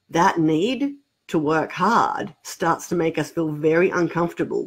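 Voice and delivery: ordinary talk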